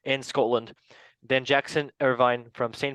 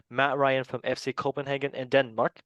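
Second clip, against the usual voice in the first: normal talking voice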